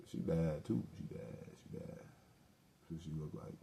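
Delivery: she bad, said under the breath; quiet murmur. Real, not acted. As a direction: drop to a whispered aside. Admiring the woman he described.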